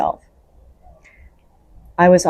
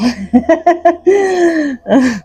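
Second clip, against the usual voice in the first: bright, high burst of laughter; real laugh; 12 semitones higher, 5 dB louder than usual. Real, not acted. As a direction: laugh at yourself, amused. Laughs at own story.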